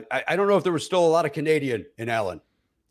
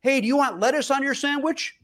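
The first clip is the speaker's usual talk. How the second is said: mock yelling; 11 semitones higher than usual. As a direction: imitate someone hollering a question. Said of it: "she's yelling every ingredient".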